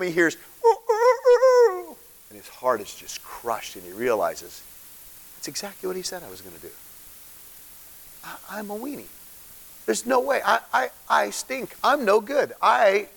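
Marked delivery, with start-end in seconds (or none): 0.6-2.0 high, slow crowing rooster call. Retold or imitated. Rooster crows at Peter. 5.4-13.1 crushed self-reproach. Retold or imitated. Peter's crushed inner voice.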